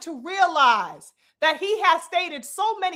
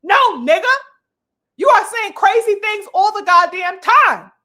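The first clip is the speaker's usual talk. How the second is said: loud, higher-pitched outburst; exasperated rebuttal; 5 semitones higher, 9 dB louder than usual. Acted. Commentator rebuts the boxer's excuse, exasperated and loud. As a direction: fire back loudly in exasperation. Acted comeback to the boxer's complaint.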